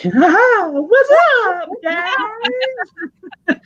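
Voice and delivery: very high, loud, jokey hail; retold over-jolly attempt to defuse things; forced joking greeting. Clown jokes with armed police to defuse it, forced jolly greeting. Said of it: "diffuse it with, you know, some kind of s-, humor".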